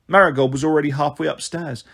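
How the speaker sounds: ordinary delivery, nothing marked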